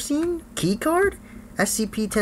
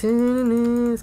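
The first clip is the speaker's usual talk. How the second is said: higher, half-speed and stretched out; drawn-out repeat; 5 semitones higher than usual. Real, not acted. Player coaching himself round the X, drawn out. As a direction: draw the words out slowly on a raised pitch.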